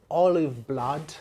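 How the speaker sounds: usual speaking voice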